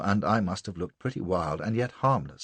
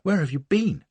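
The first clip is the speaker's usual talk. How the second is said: demanding; 10 semitones higher than usual. A narrator's reading. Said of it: "she demanded".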